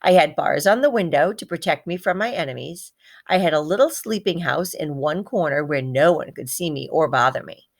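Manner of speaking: ordinary delivery, nothing marked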